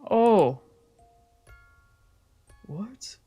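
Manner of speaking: ordinary talk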